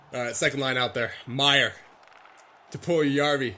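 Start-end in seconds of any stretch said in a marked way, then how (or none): none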